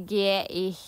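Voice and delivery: ordinary talk, nothing marked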